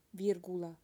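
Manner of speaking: usual speaking voice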